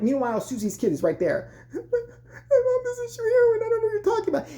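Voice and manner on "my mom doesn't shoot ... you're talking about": put-on wailing child. Kid defending her mom, high mock wail. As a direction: squeal it out like a tearful kid, hammed up. Voicing Susie's crying kid.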